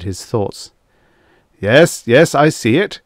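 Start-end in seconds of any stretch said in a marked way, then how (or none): 1.6-3.0 loud, higher shout aside. A narrator's reading. Colonel shouting to someone on the other end. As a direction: call it to someone across the room. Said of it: "The Colonel was shouting at someone".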